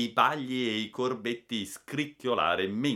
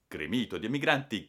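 ordinary delivery, nothing marked

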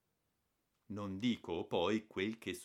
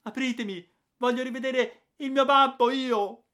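put-on boy's high full-throated yell, much louder; screaming at the top of his lungs; 15 dB louder than usual. A narrator's reading. Boy locked in, screaming for his father through the door. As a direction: pound the door and scream to be let in. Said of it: "urlando a squarciagola".

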